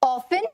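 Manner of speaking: often said as OFF-un, no T sound; often pronounced without its T; often with silent T. Shown as a demonstration. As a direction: say often like "awfun", the T left silent. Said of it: "बोलना कैसे है".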